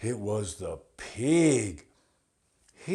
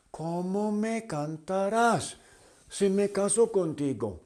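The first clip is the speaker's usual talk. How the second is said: much higher, louder, quick questioning; butterfly voice; 10 semitones higher, 4 dB louder, faster than usual. Acted. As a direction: squeak it out as a dainty butterfly. Butterfly voice, high and loud.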